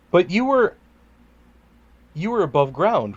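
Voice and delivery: ordinary talk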